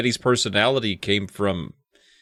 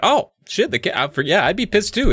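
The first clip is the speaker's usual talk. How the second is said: mock sudden realisation; 7 semitones higher than usual. Retelling own sudden realisation.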